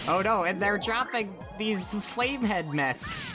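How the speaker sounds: ordinary talk, nothing marked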